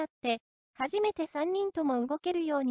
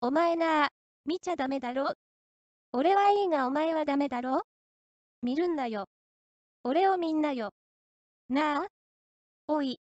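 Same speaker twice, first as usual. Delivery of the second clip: eerie unchanged tone. Retold or imitated. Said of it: "その口調のまま言った".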